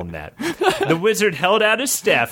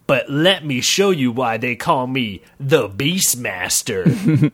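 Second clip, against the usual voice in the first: slow boastful hero boast; slower than usual. Acted. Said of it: "said the wood elf".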